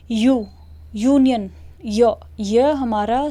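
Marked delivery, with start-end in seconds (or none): 0.1-1.5 "यू" alone, a pause, then "यूनियन" starting with a y-glide; pronunciation demonstration. Shown as a demonstration. Teacher showing the first sound of union, "यू" isolated before "यूनियन". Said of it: "pronounce कर रहे हैं तो हम क्या बोल रहे हैं".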